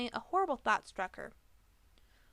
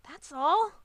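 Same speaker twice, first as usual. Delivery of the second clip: higher, louder shouted answer; 7 semitones higher, 7 dB louder than usual. A narrator's reading. Pegasus defends keeping secret, shouting. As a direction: throw it back defensively. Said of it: "the Pegasus shouted".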